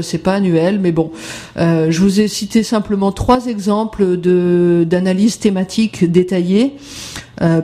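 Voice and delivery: ordinary delivery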